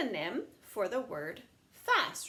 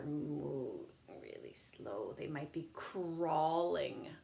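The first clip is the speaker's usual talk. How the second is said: slow drawl; 6 semitones lower, 6 dB quieter, slower than usual. Acted. Teacher evokes something slow.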